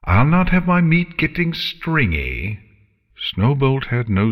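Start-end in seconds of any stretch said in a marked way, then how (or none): 0.0-2.6 higher, lightly voiced menacing dragon. A narrator's reading. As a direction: threaten like a hungry dragon. Dragon Scarlet speaks.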